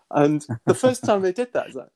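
ordinary talk, nothing marked

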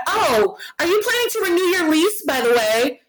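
mock sweet question. Imitating the leasing girl's question.

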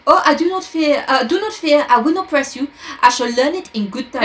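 put-on reassuring French-accented sleuth; Poirot, the detective. A narrator's reading.

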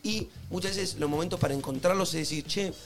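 soft relaxing ad read. Acted. Host pitching incense to listeners, softly and calmly. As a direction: sell tranquility gently and quietly.

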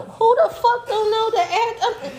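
high, cracking laugh through the words; can barely get it out for laughing; laughing fit. Real, not acted. Losing it laughing.

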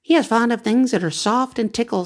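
high, small bat voice; little bat, lightly voiced, high. A narrator's reading. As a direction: squeak out the news.

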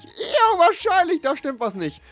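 mock realisation. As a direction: squeal it like the penny just dropped. Realising something is wrong. (hohe, laute gespielte Erkenntnis)

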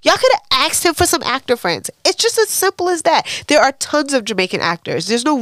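high, loud indignant outburst; worked-up, emphatic protest; exasperated rant. Real, not acted. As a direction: argue it hotly, pitch shooting way up. Ranting about fake Jamaican casting.

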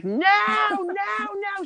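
high, loud screaming no; shrieking no at the top of her lungs; retold scream. Girl screaming as her friend attacks, high shout. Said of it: "She's screaming at the top of her lungs".

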